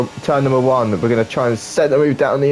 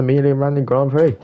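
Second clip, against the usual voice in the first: Italian accent; 4 semitones higher, faster than usual. Shown as a demonstration. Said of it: "I have to kind of do it in that Italian accent".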